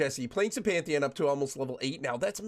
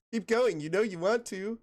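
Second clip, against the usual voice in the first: coaxing friend; 9 semitones higher than usual. Retold or imitated. Imitating a friend urging him to keep playing Riven, mock pleading. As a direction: egg him on, half laughing. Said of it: "She's always like".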